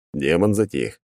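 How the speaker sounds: ordinary delivery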